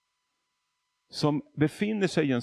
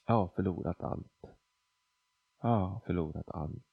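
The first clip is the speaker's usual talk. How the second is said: quiet repeated mantra; 7 semitones lower, 7 dB quieter than usual. Retold or imitated. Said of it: "sa en fras hela tiden till Gud".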